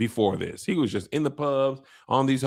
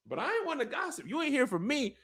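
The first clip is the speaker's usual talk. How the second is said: much higher, much softer, fast gossip impression; hushed gossip; 12 semitones higher, 8 dB quieter than usual. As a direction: squeak the secret out quickly under your breath. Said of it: "That's what Ulf said".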